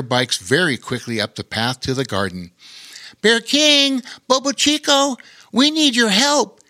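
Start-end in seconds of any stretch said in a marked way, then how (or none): 3.2-6.6 put-on high, loud urgent boy's call; yelling. A narrator's reading. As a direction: call out for help. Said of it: "yelled Lore".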